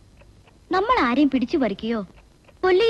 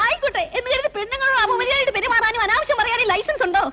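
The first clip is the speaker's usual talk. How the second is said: high-pitched, aggrieved challenge; indignant protest; 8 semitones higher than usual. Acted. Woman challenging a police officer's right to be rude, indignant. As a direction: push back in a high, complaining voice.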